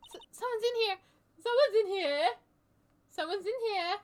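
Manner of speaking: high, loud cry of alarm; alarmed call-out. Acted. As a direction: call out that an intruder is here. Calls out alarm in game.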